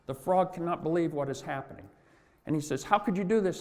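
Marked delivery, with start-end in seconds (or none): 2.8-3.6 higher, louder anguished plea; a drowning frog's disbelieving cry; desperate cry. Retold or imitated. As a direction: cry out, betrayed and sinking. Drowning frog cries out.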